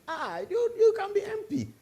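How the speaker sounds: high excited exclamation; suddenly thrilled, voice jumps high; excited praise. Retold or imitated. Mentor excitedly praising the student.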